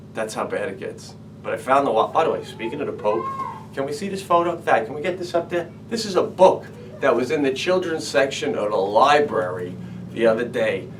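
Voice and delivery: usual speaking voice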